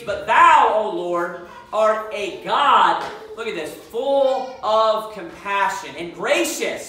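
slow, loud, drawn-out reading of the verse; emphatic scripture reading. Real, not acted. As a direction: lean hard on every word of the verse.

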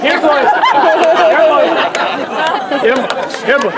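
coaxing call for a dog; calling a lost pet. Acted. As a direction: call the dog over, sing-song.

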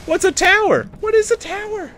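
high-pitched mock question; teasing a bad player with a silly high voice; mocking dumb voice. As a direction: play dumb in a squeaky voice.

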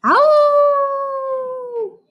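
long, high howl; coyote howl. Shown as a demonstration. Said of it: "Can you all howl with me?".

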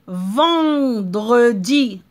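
"Vendredi" drawn out very slowly, nasal "en" with no N heard; slow modeled word. Shown as a demonstration. Teacher showing pupils the silent N in "Vendredi". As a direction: stretch "Vendredi" for the children. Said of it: "On n'entend pas le son".

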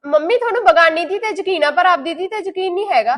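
furious wounded daughter; angry accusation. Acted. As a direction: shout out the unfairness. Daughter accuses mother of favouritism.

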